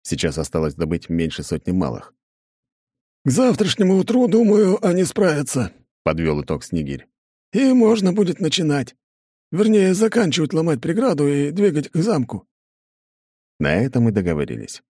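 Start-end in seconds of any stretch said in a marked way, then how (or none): 3.2-5.7 put-on light, high young woman; high female character voice. Snegir speaks, high voice. 7.5-12.5 put-on light, high young woman; high female character voice. Snegir continues.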